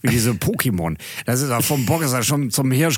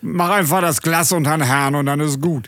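put-on drunk; 3 semitones higher than usual. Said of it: "wie ein Vieltanker".

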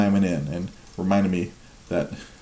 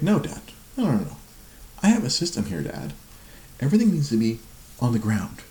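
put-on higher-pitched imagined toddler protesting to dad; objecting toddler; 5 semitones higher than usual. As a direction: put on a high little kid laying down the rules. Voicing his toddler objecting to building.

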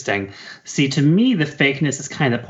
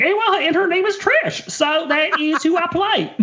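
much higher, dreamy [name] voice breaking into giggles; giggly high imitation of [name] at a press junket; [name] impression. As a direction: play [name] gushing about her character, pitched way up. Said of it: "she's like".

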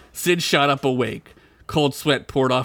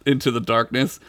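usual speaking voice

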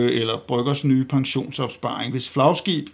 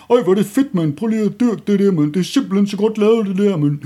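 high, loud, fast gushing "mand" voice; hammed-up over-the-top praise, much higher and louder; mocking film-crowd voice; 6 semitones higher, 6 dB louder than usual. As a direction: ham up a gushing hipster raving about a film. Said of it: "hvad man laver andet end".

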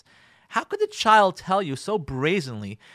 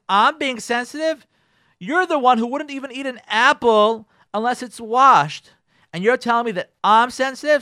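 higher, louder outraged retort; indignant comeback; 7 semitones higher, 10 dB louder than usual. Acted. Wife hitting back at husband over salad, indignant. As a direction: fire it right back at him.

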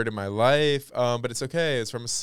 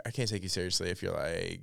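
ordinary talk

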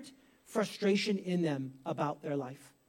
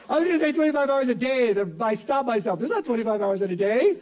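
laughing at himself; 3 dB louder than usual. Real, not acted. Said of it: "laughs at own slip".

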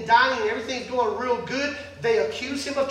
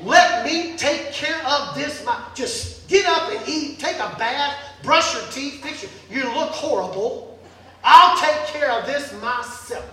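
commanding wife; 5 dB louder than usual. Acted. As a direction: take charge of a useless husband. Jezebel taking charge, commanding.

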